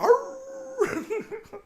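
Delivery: high, loud howl imitating a dog; imitated dog scream. Owner imitating his dog screaming in the car, loud high howl. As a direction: howl like a dog left alone. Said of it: "You can hear him from inside the shop".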